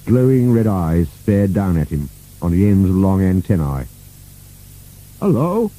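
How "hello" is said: high-pitched tentative greeting; nervous. A narrator's reading. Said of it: "said Burke nervously".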